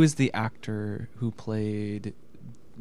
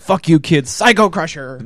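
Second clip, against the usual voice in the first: mock shout; 5 semitones higher, 8 dB louder than usual. Mock villain yelling move name.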